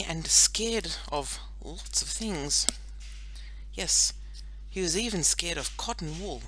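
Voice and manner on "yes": ordinary delivery, nothing marked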